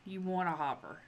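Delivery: normal talking voice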